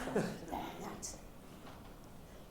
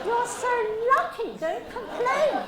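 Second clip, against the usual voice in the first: loud, slow, higher teasing "Don't complain"; mock scolding; 8 semitones higher, 10 dB louder, slower than usual. Shown as a demonstration. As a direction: drag out "Don't complain" as a playful telling-off. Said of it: "coach teasing dancer".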